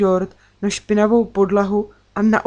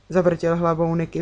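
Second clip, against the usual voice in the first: plain reading voice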